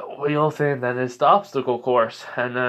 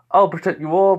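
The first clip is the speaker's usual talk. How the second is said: higher, louder heroic pledge; mock hero; 7 semitones higher, 5 dB louder than usual. Mimicking a typical hero's pledge, mock-heroic. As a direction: declare it like an earnest hero.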